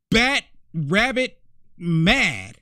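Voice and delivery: "bat rabbit mad" slowly, a beat between each word; emphatic word by word. Real, not acted. Commentator describing a furious woman in a video, emphatic word by word. Stressing how mad she is.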